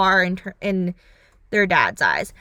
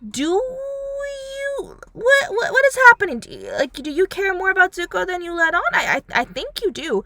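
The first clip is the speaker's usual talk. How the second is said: much higher, crying shout of disbelief; incredulous outburst; 9 semitones higher than usual. Retold or imitated. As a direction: squeal it out in disbelief, then rush the question. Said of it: "I'm like".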